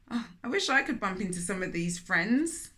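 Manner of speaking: usual speaking voice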